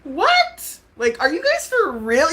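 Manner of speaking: high, loud disbelief; incredulous outburst. Real, not acted. Streamer calling out her viewers, incredulous. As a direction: blurt it out high, appalled.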